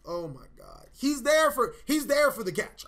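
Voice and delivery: ordinary delivery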